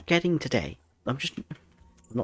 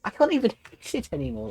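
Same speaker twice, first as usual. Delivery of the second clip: higher, fast and laughing; rushed laughing complaint; exasperated laugh; 11 semitones higher, much faster than usual. Real, not acted. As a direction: laugh through the frustration. Laughing at failure.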